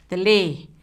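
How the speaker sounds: normal talking voice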